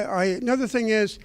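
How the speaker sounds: ordinary talk, nothing marked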